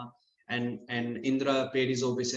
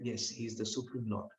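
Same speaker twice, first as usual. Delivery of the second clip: soft, low retold concession; humbled admission; 6 semitones lower, 11 dB quieter than usual. Defeated Indra realizing who Krishna is, humbled and quiet.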